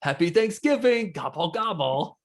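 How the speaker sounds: buddy pranking the store intercom, hammed-up announcer; mock intercom greeting. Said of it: "would get on the intercom of the grocery store and go".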